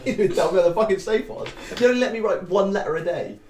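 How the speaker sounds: laughing rant. Acted. Grandson NPC rants, laughing.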